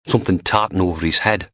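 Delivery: usual speaking voice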